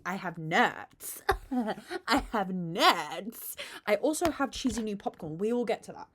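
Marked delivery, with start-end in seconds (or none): none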